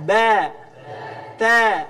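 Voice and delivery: Ba and Ta said loud and heavy, as thick letters. Shown as a demonstration. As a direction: voice Ba and Ta full and thick. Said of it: "bunyinya siga tebal".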